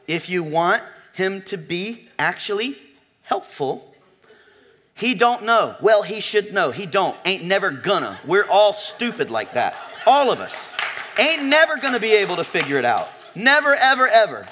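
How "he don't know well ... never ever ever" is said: rising to a loud, higher preached climax; preaching build. Real, not acted. Pastor preaching to the ladies about husbands, building loud. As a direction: hammer it home to the room. Preaching point at full force.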